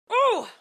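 a sharp, high, loud ooh of outrage; high indignant ooh. Real, not acted. Reacting to the internet siding with him, high outraged ooh. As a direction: squeal it out, offended.

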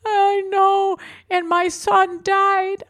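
high, very slow tearful wail; imitating sobbing woman. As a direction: drag it out in a weepy high voice. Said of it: "then she goes".

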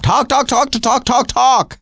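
high-pitched mock yammering; nonstop chatterer. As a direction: rattle it off high, like relentless nagging. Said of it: "she won't stop".

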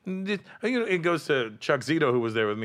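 ordinary talk

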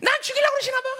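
high-pitched whine of grievance; mock complaining. Resentful believer complaining that God ignores them, mock whining. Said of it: "원망이 들어오니까 그냥 금세".

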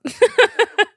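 loud high laugh at own joke; burst of laughter. Real, not acted. Laughing loudly at the thought of her manager. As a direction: crack up loudly.

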